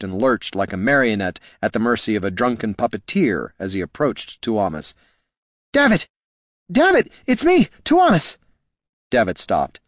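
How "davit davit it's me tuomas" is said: high, loud frightened call; panicked appeal, pitched way up; desperate pleading. A narrator's reading. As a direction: cry out to reach your friend. Pleads with his undead friend.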